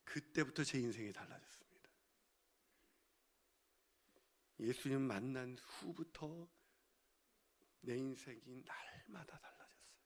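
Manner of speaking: very soft, low, nearly whispered; hushed testimony. Real, not acted. Preacher confides his life changed, hushed near-whisper. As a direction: let it sink to a slow, reverent hush.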